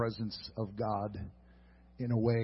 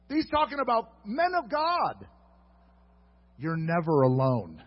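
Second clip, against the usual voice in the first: higher, loud, soaring proclamation; preaching at full force; 10 semitones higher, 8 dB louder than usual. Acted. Preacher climaxing to the congregation, loud and high. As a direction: drive it home with full fervour.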